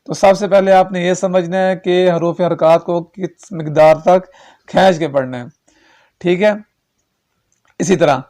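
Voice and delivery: normal talking voice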